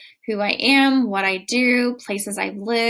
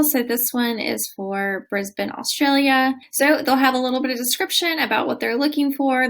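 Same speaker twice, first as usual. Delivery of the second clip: ordinary talk, nothing marked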